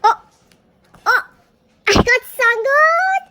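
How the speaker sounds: real jackpot elation; triumphant squeal. Real, not acted. Got the card wished for.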